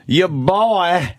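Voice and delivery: high, drawn-out Southern drawl; mock a Southern accent on "boy". Mimicking a politician's clip for the co-hosts, Southern accent. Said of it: "He's got the accent".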